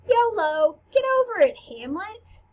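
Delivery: breezy, high-pitched mock dismissal; nonchalant queen. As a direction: brush it off like a flippant queen. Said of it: "real nonchalant about it".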